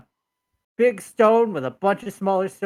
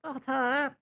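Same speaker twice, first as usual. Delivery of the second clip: frightened kobold; 7 semitones higher than usual. Acted. Kobold still pleads with party.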